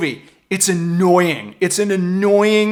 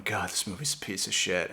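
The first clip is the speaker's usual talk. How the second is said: quiet grumble; 8 semitones lower, 13 dB quieter than usual. Retold or imitated. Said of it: "sitting there going".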